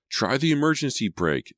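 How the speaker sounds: high-pitched urgent cry; narrated scream. A narrator's reading. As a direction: scream the instruction in panic. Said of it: "Sydney screamed".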